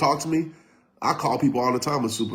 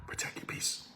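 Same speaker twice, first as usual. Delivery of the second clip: whispered, calming himself down; 18 dB quieter than usual. Shown as a demonstration. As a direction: breathe the words out to settle. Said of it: "Let me calm down".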